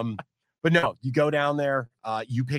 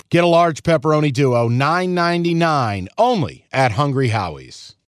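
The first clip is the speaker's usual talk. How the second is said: loud, punchy radio ad read, pushing each deal; live ad read; 8 dB louder than usual. Acted. Host reads the pizza ad to listeners, loud and punchy. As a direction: sell the pizza deal loudly, like a radio spot.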